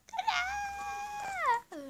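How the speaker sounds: squeaky high "Tada!" shouted in jest; hammed-up reveal cry; mock fanfare. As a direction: present it like a magic trick. Presenting the ugly house.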